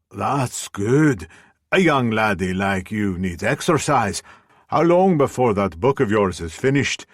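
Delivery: chatty Scottish landlady greeting a regular; Scottish brogue. Acted. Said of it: "her thick Scottish brogue".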